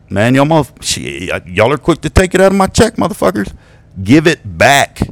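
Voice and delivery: hammed-up Mexican-American accent, demanding money back; sudden joke accent. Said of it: "that accent came from out of nowhere".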